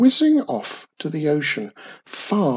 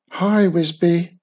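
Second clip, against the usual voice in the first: higher, louder greeting breaking in; cheery interruption; 4 semitones higher, 5 dB louder than usual. A narrator's reading. Witch interrupting her cousin's counting, cheery call. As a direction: burst in with a hello. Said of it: "Wizardy Witch interrupted".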